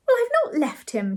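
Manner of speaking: high-pitched, irritating retort; annoying voice. A narrator's reading. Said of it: "said Ethel in an annoying voice".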